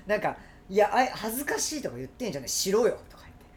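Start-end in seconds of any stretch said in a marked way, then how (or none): 0.7-3.0 loud, raised mock telling-off; scolding demand. Wife scolding shy husband about showing affection, blunt. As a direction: imitate a wife who takes no excuses.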